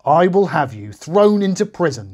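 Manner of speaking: high, loud merciless threat; shout. A narrator's reading. The first servant shouting at the debtor. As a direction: shout the threat without mercy. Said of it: "he shouted".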